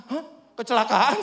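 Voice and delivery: loud, rising startled question; retold shocked echo. Preacher hearing his neighbour had an accident, startled. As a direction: echo the news in loud disbelief.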